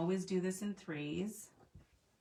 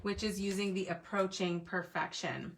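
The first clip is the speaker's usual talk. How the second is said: ordinary talk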